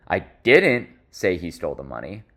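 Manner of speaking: "didn't" lifted in pitch and punched, then a slight pause; stress on DIDN'T. Shown as a demonstration. Coach showing how meaning changes with pitch, stress on "didn't". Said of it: "change the pitch emphasis on each word".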